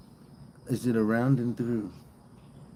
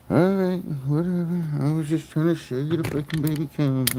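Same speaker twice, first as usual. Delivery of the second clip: higher, louder huffy brush-off; mock offended; 6 semitones higher, 5 dB louder than usual. Real, not acted. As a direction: pretend to be hurt the calf left. Calf walks away.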